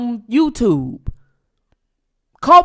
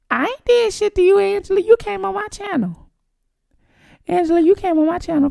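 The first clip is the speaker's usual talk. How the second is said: high-pitched plaintive protest; mock-innocent protest; 6 semitones higher, 4 dB quieter than usual. Telling Angela she came on the channel, mock-innocent protest. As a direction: protest in a high, put-upon voice.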